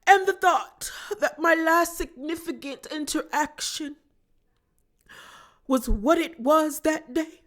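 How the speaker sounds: mock a grand, theatrical confession with a put-on accent; overly profound Downton Abbey accent, imitating Meredith; Meredith's posh accent. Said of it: "this accent and the dramatics and these profound dialogues".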